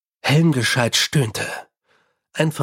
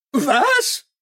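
a sudden, much higher yelp of shock; startled outburst; 12 semitones higher than usual. A narrator's reading. Fred in the corner, blurting in shock at the theft. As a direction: blurt it out in surprise. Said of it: "entfuhr es Fred Collon".

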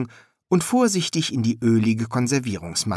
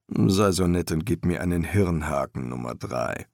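low master's voice; composed working master; master embalmer, businesslike; 4 semitones lower than usual. A narrator's reading. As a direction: instruct the apprentice in a lower voice.

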